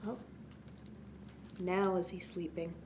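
plain reading voice

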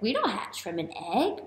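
ordinary delivery

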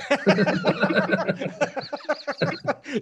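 a high, hearty burst of laughter; big real laugh. Real, not acted. Guest laughs at the question.

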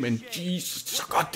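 soft, breathless whisper of awe; awed hush. Real, not acted. Gamer gushing over favourite game, hushed whisper. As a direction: breathe it out, overwhelmed. Gushing about Grim Fandango.

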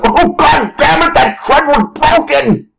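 high, drawn-out anguished yelp; over-acted strangled exasperation; mock cursing outburst. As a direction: wail in hammed-up fury at the broken trend. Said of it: "that skip will be the".

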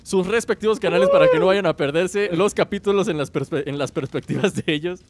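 youtuber outro. Acted. Channel outro.

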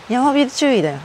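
ordinary talk

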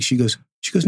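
ordinary talk, nothing marked

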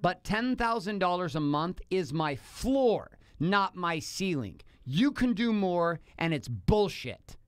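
very slow, each word laid down; slow firm quote. Retold or imitated. Husband quoting wife's money ultimatum, slow and firm. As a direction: spell out the ultimatum slowly. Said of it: "She says".

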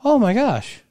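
high, gushing happy surprise; delighted surprise. Retold or imitated. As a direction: gush like an unexpected gift arrived. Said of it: "she would see those and go".

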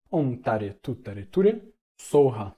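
Om Tare Tu Tare Ture Soha chanted slowly with Soha loud; Tibetan pronunciation. Shown as a demonstration. Teacher showing the Tibetan pronunciation of the mantra. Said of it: "essa é a pronúncia tibetana".

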